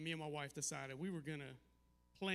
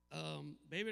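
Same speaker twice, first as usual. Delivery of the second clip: normal talking voice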